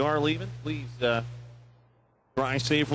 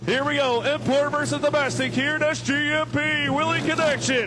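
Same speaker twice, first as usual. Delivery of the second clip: race announcer hype; 8 semitones higher than usual. Real, not acted. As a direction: call the race like the crowd's on fire. Live drag race commentary.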